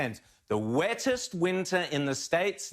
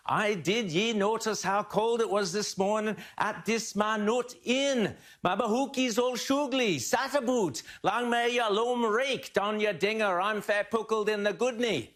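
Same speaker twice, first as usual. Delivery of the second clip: higher, hammed-up Scottish brogue; broad Scottish accent for laughs; mock Scottish accent; 5 semitones higher than usual. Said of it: "Or words to that effect".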